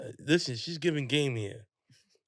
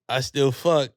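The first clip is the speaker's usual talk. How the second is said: loud line breaking into big laughter; cheeky admission collapsing into loud laughter; laughing confession; 11 dB louder than usual. Real, not acted. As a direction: blurt it out loudly, then crack up. Jokey confession, bursts out laughing.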